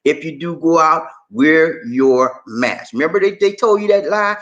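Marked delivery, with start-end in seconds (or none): 1.3-2.9 each word slow and leaned on; slow emphatic plea. Real, not acted. Host urging viewers to protect themselves, slow and emphatic. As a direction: press the point home slowly.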